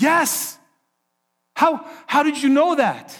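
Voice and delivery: high, astonished yes and then how did you know; stunned surprise. Retold or imitated. Stranger on the train, astonished that his wife is named: high surprise. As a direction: disbelief leaping up in the voice. Stunned man hears wife's name.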